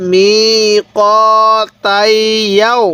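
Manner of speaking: very slow, every syllable stretched; drawn-out reading. Shown as a demonstration. Teacher drawing out the word for students, very slowly. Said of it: "এরকমভাবে পড়ব".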